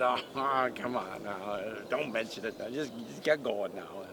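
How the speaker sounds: low, chuckling duck voice; bashful duck. Acted. Bashful duck waves off thanks.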